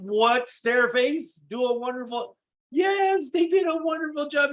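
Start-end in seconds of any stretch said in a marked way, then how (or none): 2.7-4.5 much higher, louder enthusiastic reply; eager yes. Retold or imitated. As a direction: gush agreement like a happy prospect. Prospect answers icebreaker eagerly.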